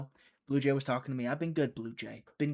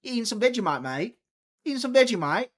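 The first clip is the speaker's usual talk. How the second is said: loud teasing mock Australian voice; hammed-up Aussie, louder and higher; playful Australian-accented tease; 7 semitones higher, 6 dB louder than usual. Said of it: "Wallpaper Settings is Australian".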